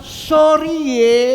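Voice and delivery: loud, slow drawn-out sorry ye; mock refusal. Pastor playfully refusing his doctor friend, loud and slow. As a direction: wag a finger as you refuse. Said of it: "saya bilang".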